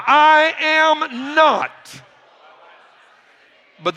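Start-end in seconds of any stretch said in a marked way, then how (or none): 0.0-2.0 high, very drawn-out words; emphatic congregational declaration. Shown as a demonstration. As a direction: lead the crowd word by slow word. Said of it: "say it one more time".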